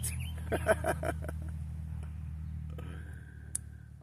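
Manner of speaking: long chuckle after own joke; laughing. Real, not acted. Camper amused by own old accent, laughing. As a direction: laugh it out at your own impression.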